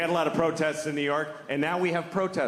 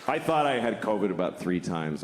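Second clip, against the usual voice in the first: usual speaking voice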